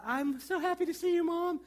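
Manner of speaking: high, sweet acted greeting; mock gushing. Imagined relieved greeting to child.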